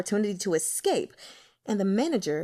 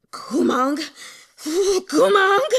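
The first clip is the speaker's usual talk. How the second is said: raging shout, climbing much higher and louder; 9 semitones higher, 8 dB louder, much slower than usual. A narrator's reading. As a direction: hurl the name out twice in rage. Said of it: "he raged".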